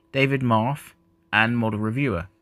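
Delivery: usual speaking voice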